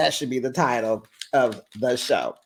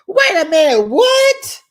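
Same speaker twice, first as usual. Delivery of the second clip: loud, high-pitched squawk of disbelief; hammed-up shouting, voice leaping up high; mock shocked outburst; 9 dB louder than usual. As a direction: imitate him yelling in alarm. Acts out Joey's shocked reaction.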